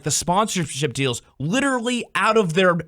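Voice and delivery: ordinary talk, nothing marked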